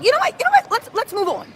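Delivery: mock a lawyer hastily dropping the subject; flustered lawyer. Host mocks the lawyer retreating after the bad answer, squeaky. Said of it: "Mark Agnifilo was like".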